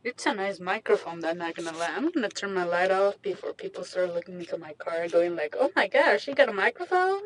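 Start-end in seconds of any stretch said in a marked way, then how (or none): 5.6-7.2 higher, louder gawking onlooker; mock astonishment. People looking into the car at her, mock astonishment. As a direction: play a stunned passer-by, pitch climbing into the question.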